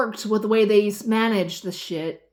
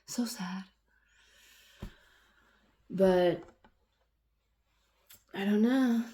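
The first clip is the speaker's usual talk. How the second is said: softer wistful whisper; 5 dB quieter, slower than usual. Real, not acted. Woman lamenting lost manners, whispering to herself. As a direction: breathe it out as a sigh.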